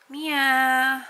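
loud wake-up call. Real, not acted. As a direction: call her name to wake her. Waking up sleeping daughter.

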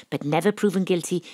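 ordinary delivery

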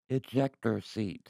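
slow, soft robot announcement; deadpan car computer. Acted. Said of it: "And then I go".

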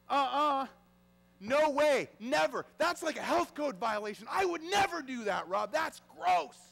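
loud, high, emphatic protest; mock horrified refusal. Churchgoer refusing a goblet of blood, mock horrified. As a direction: refuse outright, playing a disgusted churchgoer.

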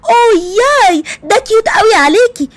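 high, lightly mocking girly squeal; mocking the dress-shop girl's cutesy talk; mock girly gushing. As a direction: imitate a gushing salesgirl. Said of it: "البنت ... تقول لي".

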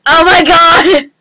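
high, loud, slow oh my god; shocked exclamation. Real, not acted. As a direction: gasp it out loud. Loud reaction to a friend's joke.

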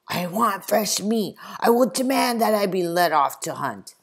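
pompous gentleman's loud demand. Acted. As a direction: insist on your rights.

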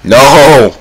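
loud, high cry of dismay; sharp frustrated shout. Real, not acted. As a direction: yell it at the missed jump. Failing a parkour jump.

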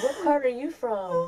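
high, slow mock-English question through laughter; pretend English accent.

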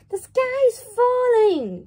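high, loud frightened chick voice; panicked cry. Acted. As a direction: shriek the news in terror. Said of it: "cried Chicken Licken".

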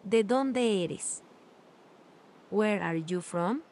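ordinary talk, nothing marked

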